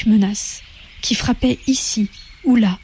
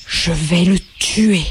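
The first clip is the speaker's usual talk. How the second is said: low, slow, spitting each word; spat threat; 3 semitones lower, slower than usual. Acted. Bigwig spits his threat at Primroll, slow and low. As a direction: spit it out through clenched teeth. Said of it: "en crachant à travers ses moustaches".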